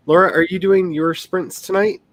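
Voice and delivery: ordinary delivery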